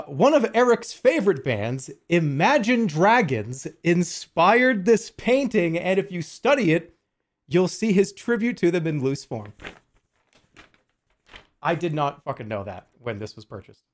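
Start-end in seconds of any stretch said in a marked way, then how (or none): 0.0-9.5 slow, loud, pompous box-blurb read; mock grand reading. Streamer reading the puzzle box to chat, mock grand.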